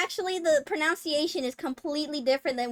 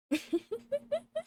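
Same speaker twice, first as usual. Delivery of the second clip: high laugh; 14 semitones higher, 6 dB quieter than usual. Real, not acted. Pronunciation joke.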